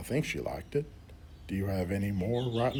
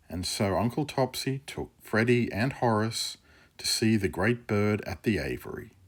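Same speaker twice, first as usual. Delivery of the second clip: loud storybook narrator; story narration; 8 dB louder than usual. A narrator's reading. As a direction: announce the scene change boldly. Narrator bridges to next scene.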